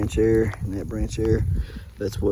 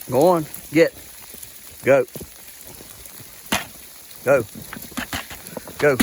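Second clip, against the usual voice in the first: short, raised-voice orders to goats; herding commands; 4 semitones higher than usual. Real, not acted. Driving goats to their food.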